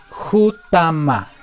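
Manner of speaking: Jutama said very slowly, each syllable clear; slow careful word. Shown as a demonstration. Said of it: "Hay una forma más". (Jutama despacio, sílaba por sílaba)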